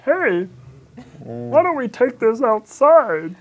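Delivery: high, slow and sing-song. Pretend. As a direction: try the line sweet and slow. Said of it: "What if I said it".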